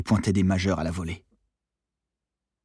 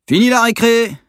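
high, loud bellow across the water; bellowed order; 12 dB louder than usual. A narrator's reading. Monitor calling sailors back in, bellowed. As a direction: yell it out like a camp instructor. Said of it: "gueula Yo-Yo".

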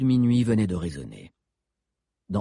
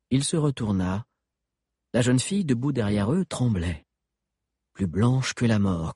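usual speaking voice

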